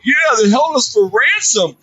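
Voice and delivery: a colleague yelling out in a debrief, mock yell; retold yell. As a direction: shout it out like a heckler in a meeting. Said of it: "And then one guy yelled".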